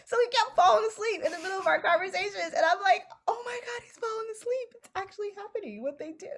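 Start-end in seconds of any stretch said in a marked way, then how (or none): 3.3-6.3 giddy hushed amazement. Retold or imitated. Idol falling asleep beside her.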